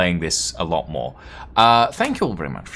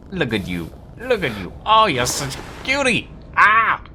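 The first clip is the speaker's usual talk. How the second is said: cooing at pet; 7 semitones higher than usual. Real, not acted. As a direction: gush over a cute animal.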